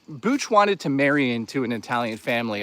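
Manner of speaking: normal talking voice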